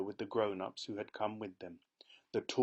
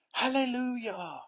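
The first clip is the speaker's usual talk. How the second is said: joyful yell; 14 semitones higher, 7 dB louder than usual. A narrator's reading. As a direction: yell praise in delight. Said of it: "yelled Grandpa Joe".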